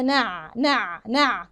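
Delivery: "نع" with the ayn held briefly; timed ayn. Shown as a demonstration. Teacher drills the ayn's timing, held evenly. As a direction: let the ayn ring on in "نع". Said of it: "العين هديلها الزمن".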